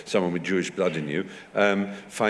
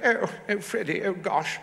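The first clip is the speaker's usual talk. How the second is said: put-on high-pitched, old-film upper-class English accent; Celia Johnson accent; 12 semitones higher than usual. Shown as a demonstration. Showing actors' middle-class Brief Encounter accent, in mock high English. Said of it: "a sort of Celia Johnson accent".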